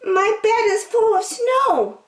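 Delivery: very high, small squirrel voice; high little critter pleading for a bed. A narrator's reading. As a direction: squeak it out like a tiny cold squirrel. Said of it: "said the squirrel".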